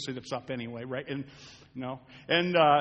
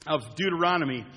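normal talking voice

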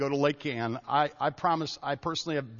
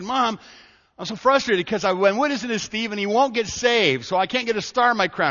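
put-on higher, louder young boy complaining to mom; frustrated boy; 7 semitones higher, 6 dB louder than usual. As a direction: play the kid, frustrated that his friend won't listen. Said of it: "I came home and I said".

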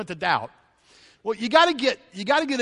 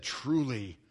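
lower, softer awed confession; hushed worship; 8 semitones lower, 7 dB quieter, slower than usual. Retold or imitated. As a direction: breathe it out in awe. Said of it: "they said".

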